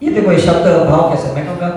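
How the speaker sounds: usual speaking voice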